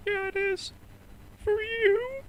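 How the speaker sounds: high falsetto mouse, mock crying; tearful cartoon mouse impression, loud and high; Mickey Mouse, near tears. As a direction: squeak it out as if about to cry. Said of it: "nearly hear him crying when he says it".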